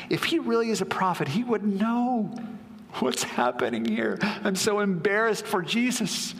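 high-pitched, indignant disbelief; scandalised inner thought. Acted. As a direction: voice Simon's mortified thoughts, pitched well up. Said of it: "thinks Simon to himself".

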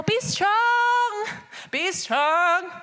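lightly grand, solemn declaration; classical stately. Retold or imitated. Classical God speaking to his people, lightly solemn. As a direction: intone it like old-fashioned scripture. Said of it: "maybe y'all are more classical".

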